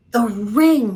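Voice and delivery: screamed. A narrator's reading. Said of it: "she screamed".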